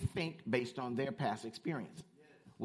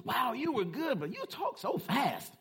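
high-pitched, louder lady's exclamation; imitated gushing lady; 12 semitones higher, 4 dB louder than usual. Lady coming up to the minister afterwards, imitated high gushing. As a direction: mimic an amazed admirer, pitched way up, stretching the end.

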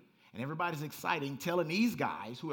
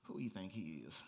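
skeptical scoff; 9 semitones lower, 8 dB quieter than usual. Retold or imitated. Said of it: "he'd be like".

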